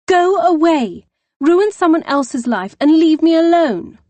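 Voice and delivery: high, loud yell through a door; furious shout. Acted. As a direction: scream at her sister to leave. Sister yells through closed door.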